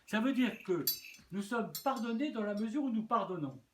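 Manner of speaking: normal talking voice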